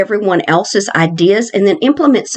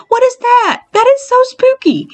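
high frightened exclamation; spooked. Real, not acted. Vlogger spotting something creepy in the maze, high spooked cry. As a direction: gasp it out, high and alarmed.

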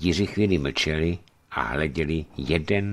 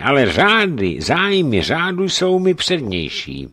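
brisk; 9 semitones higher, 6 dB louder than usual. Acted. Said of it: "odpověděl rázně".

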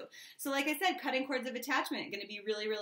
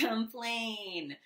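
usual speaking voice

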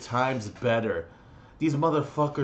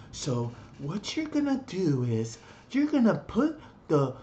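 higher, over-explaining mock voice; condescending teammate; 5 semitones higher than usual. Gamer imitating a teammate who talked down to him, mildly condescending. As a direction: talk down as if to a child. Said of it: "purposely talking to me like an idiot".

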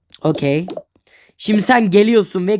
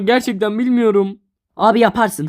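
ordinary talk

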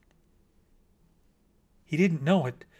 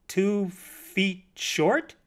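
very slow, higher, uncomprehending question; blank puzzlement; 6 semitones higher, much slower than usual. A narrator's reading. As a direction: echo it word by word, baffled, rising at the end. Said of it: "The bat said blankly".

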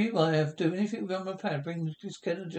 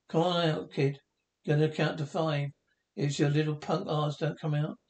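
ordinary talk, nothing marked

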